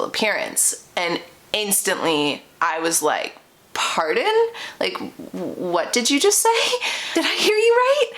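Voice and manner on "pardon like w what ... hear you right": rising, incredulous "Pardon?" ending in a laugh; can't believe what she just heard; shocked disbelief. Retold or imitated. To her date after his cruel comment, stunned and incredulous.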